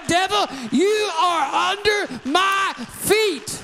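slow, high, shouted climax; a preacher declaring victory over the devil, shouting slowly; triumphant shout. Acted. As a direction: stamp the devil down with each word.

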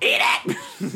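high, sharp yell; grandmother's command. Retold or imitated. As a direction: bark it like a strict granny. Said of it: "My grandmother used to force me".

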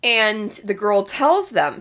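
ordinary talk, nothing marked

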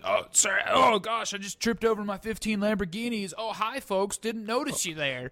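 high-pitched, hammed-up surprise at the camera; fake-nonchalant video host. Braggart opening his selfie video, badly faked nonchalance. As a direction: pretend to be casually caught off guard. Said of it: "start this video in a really shitty manner".

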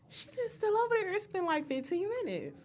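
high-pitched, put-out disbelief; acting out impatient thoughts; high, incredulous grumble to herself; impatient inner voice. Acts out her impatient thoughts.

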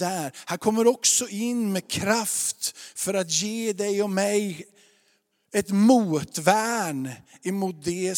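fervent, building sermon cadence; rising preacher's proclamation; preaching. Acted. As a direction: proclaim it like a preacher in full flow. Preaching to the congregation.

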